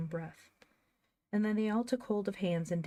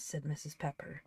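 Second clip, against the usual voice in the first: ordinary delivery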